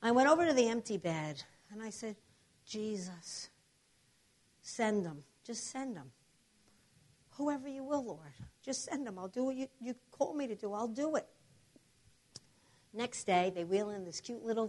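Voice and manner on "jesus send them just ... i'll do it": soft, earnest plea; hushed prayer. Retold or imitated. Patient praying over an empty bed, hushed surrender. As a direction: pray quietly, leaving space between phrases.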